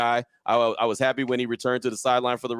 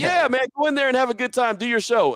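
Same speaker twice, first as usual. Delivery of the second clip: much higher, bright encouraging coach; a coach sending the host off with cheer; 1 imitating Coach Gruden; 11 semitones higher than usual. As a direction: wave him in like a friendly coach. Gruden voiced in retold story.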